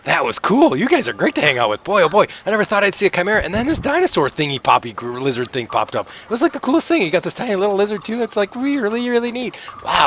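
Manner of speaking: high-pitched, gushing chatterbox character; eager high voice, tumbling from thought to thought; excited rambling. Acted. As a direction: gush on and on without letting up. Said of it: "he just keeps going on and on".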